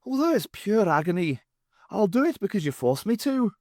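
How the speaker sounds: high, hammed-up martyred pleading; mock suffering Irishman. A narrator's reading. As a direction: play the put-upon victim.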